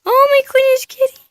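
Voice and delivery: high squeaky coo to the cat. Real, not acted. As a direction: gush over the kitty. Child cooing at the cat.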